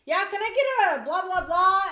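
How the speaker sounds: loud, high brusque customer demand; rude customer. Retold or imitated. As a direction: bark the order without a greeting. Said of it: "they'll be like".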